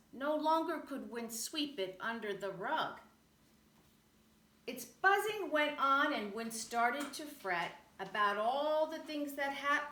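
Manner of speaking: plain reading voice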